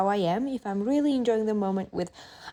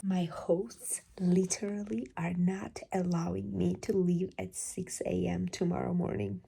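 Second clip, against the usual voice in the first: whispering; 5 dB quieter than usual. Real, not acted. Whispered vlog at night.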